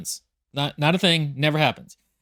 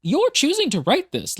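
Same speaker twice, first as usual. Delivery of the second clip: mock exasperation; 9 semitones higher than usual. Reader scolds the author, retold.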